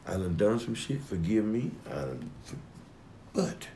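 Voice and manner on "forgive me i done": normal talking voice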